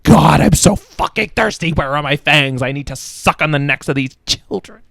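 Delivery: hammed-up desperate outburst, loudest at the start; distraught vampire. Voicing Count Nightwing in the picture, hammed-up distraught. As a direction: burst out as a thirsty, fangless vampire, then ease off. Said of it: "he's just so distraught. He's like".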